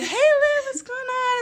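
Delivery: high-pitched, sing-out hello; breezy greeting. Retold or imitated. Friend dancing over to interrupt Liv, breezy high greeting. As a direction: pipe up high, as if casually dropping by.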